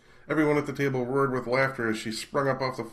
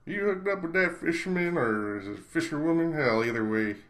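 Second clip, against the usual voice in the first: higher Southern drawl, teasing a buddy; 6 semitones higher, 3 dB louder than usual. A narrator's reading. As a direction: lay on a Southern twang. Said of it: "in his strong Southern accent".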